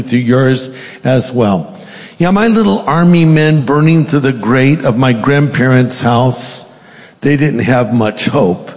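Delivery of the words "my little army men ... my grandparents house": ordinary talk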